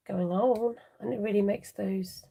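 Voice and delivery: ordinary talk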